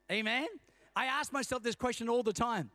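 normal talking voice

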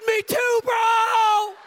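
high, loud, slow relieved yell; excited high shout. Retold or imitated. Friend in red overalls shouts his confession, overjoyed. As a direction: blurt it out with joy. Joyful reply before hugging.

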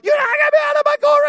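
very high, loud, fast garbled screech; frantic yelling. Acted. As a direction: screech over someone while struggling, words tumbling. Acted chaotic shouting while woman is removed.

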